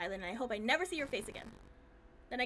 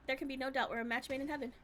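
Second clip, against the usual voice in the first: ordinary delivery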